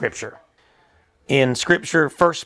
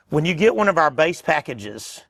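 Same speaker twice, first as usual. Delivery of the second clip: normal talking voice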